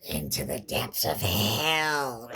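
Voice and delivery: doom him, slowly and darkly; ominous demon voice. Retold or imitated. Girl revealing Hulk's fate, slow and sinister.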